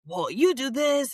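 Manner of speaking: slow, high mock bickering child; whiny kid. Kids nitpicking at dinner table.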